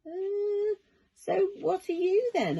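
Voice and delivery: put-on high child voice, curious; curious boy. A narrator's reading. Charlie asking the creature what it is, high boy voice. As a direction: ask like a wary little boy.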